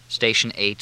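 plain reading voice

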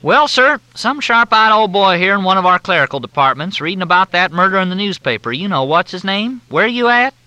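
high, loud, syrupy Southern drawl; Southern officer on the phone, in a thick drawl. Acted. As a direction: drawl it out like a courteous Southern major. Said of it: "The drawl was so steeped in courtesy".

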